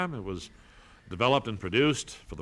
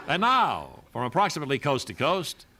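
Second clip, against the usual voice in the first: louder, higher show announcer voice; announcer intro; 3 semitones higher, 4 dB louder than usual. Acted. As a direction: open the show like a grand radio host.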